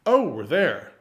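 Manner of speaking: loud theatrical "Oh, we're there", "Oh" falling; bright commedia surprise at arriving; exaggerated arrival. Shown as a demonstration. Teacher shows students the commedia journey: loud staged surprise at arriving. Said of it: "So it's that style of acting".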